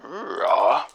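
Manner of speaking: loud growling bear noise. Acted. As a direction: growl like a bear answering yes. Player answers as the bear.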